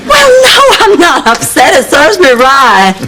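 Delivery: hurt denial. Acted. Blanche insists to her friend she is not upset, voice cracking with laughter. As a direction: cover the hurt with a forced laugh. Told her date is taken.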